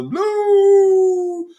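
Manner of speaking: very high, held hoot on Blue; sustained high-pitched title cry; drawn-out show call. Real, not acted. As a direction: belt out a long, loud, soaring Blue. Show host calls out show title.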